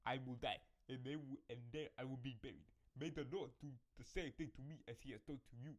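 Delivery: put-on a light Jamaican accent for Ruth. Reading Ruth's vow to Naomi in a light Jamaican accent. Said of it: "your Jamaican accent voice?".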